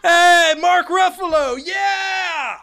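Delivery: high, loud cheering shout; excited shout. Acted. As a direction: yell his name like a fan screaming.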